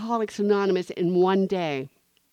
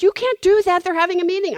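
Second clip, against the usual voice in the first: much higher and louder, panicky warning; alarmed protest; 12 semitones higher, 10 dB louder, faster than usual. Retold or imitated. The teller stopping her from barging into the meeting, alarmed. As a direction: try to stop her in alarm.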